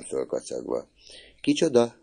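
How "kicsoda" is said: high-pitched cry of a question; exclaimed. A narrator's reading. Mother asking who was meant, exclaimed. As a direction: exclaim it in disbelief. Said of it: "kiáltotta".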